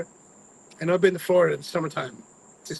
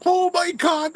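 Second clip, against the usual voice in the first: much higher, loud excited cry; thrilled shout; 6 dB louder, slower than usual. Retold or imitated. Seeing the Magic Kingdom sign, a high thrilled shout. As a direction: burst out like a thrilled kid.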